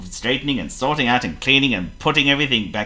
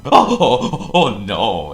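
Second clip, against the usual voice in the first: a tidy man discovering his wrecked bathroom; gasping in dismay; 2 semitones higher, 6 dB louder, much slower than usual. Acted. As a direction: gasp at the mess, horrified. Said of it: "he gasped".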